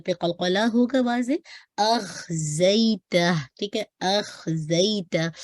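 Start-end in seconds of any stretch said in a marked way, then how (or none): 1.8-5.3 slow "अख़ज़ैता" with a heavy kha; recited slowly. Shown as a demonstration. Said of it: "खा को heavy".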